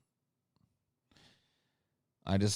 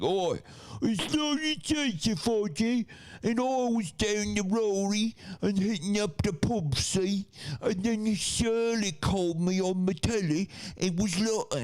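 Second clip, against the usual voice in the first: very high, incomprehensible Cockney jabber; hammed-up Cockney at a squeaky pitch; mock Cockney. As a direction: blurt out exaggerated Cockney slang. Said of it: "But Cockney, sometimes one of those".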